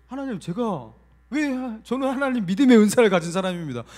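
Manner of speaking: loud, high-pitched pleading; indignant protest. Retold or imitated. Pastor defends his faith to God, loud indignant protest. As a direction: insist on it loudly, voice pushed up.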